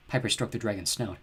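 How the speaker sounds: plain reading voice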